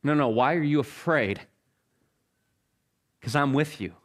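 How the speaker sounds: calm a frightened friend; Jesus calming a frightened disciple; reassuring. Acted. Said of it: "Jesus would probably respond back".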